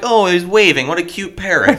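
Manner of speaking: high, loud cooing voice; cooing onlooker. Retold or imitated. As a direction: gush over a cute bird. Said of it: "They're like".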